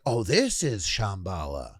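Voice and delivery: slow mock-awed proclamation; clowning. Festival-goer walking around clowning, slow mock awe. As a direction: draw it out in put-on wonder. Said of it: "I was kinda clowning it".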